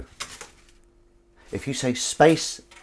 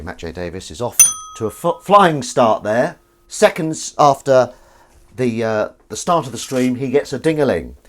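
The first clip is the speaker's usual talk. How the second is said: mock commentator; 6 dB louder than usual. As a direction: play it like a light sports commentator calling a flying start. Mock sports commentary on a live stream.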